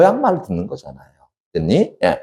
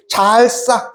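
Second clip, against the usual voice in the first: a drawn-out, higher slap of a wave; splashing sound word; 10 semitones higher, much slower than usual. Shown as a demonstration. Lecturer acts out the poem's wave sound, drawn-out splash. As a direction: stretch it out like a wave smacking.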